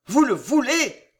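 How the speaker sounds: loud, high agitated question; panting. A narrator's reading. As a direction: gasp it out, overwrought. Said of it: "haleta Charles neuf".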